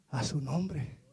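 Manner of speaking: soft, low reply through back pain; pained murmur. Acted. Beaten Silas answering Pablo from the stocks, low and pained. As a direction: answer as if every word hurts. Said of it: "porque le dolía la espalda".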